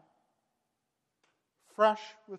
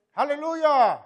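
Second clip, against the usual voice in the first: same loud, high hallelujah for the joke; mock Chinese way to say "Hallelujah!", just shouted; playful language joke; 7 semitones higher, 11 dB louder than usual. Shown as a demonstration. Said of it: "how you say that in Chinese".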